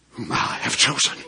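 normal talking voice

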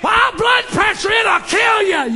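very high, loud shout; full-force preaching. Real, not acted. Preacher voicing what blood pressure threatens, shouted.

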